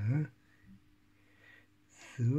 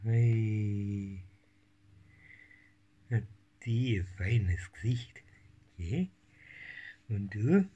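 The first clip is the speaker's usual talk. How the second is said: ordinary talk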